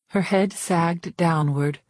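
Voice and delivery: usual speaking voice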